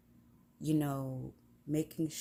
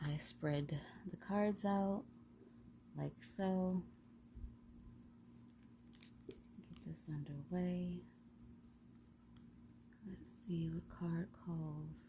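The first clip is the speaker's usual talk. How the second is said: soft whisper; 11 dB quieter than usual. Acted. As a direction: whisper it, unhurried and secret. Spreading and drawing the cards.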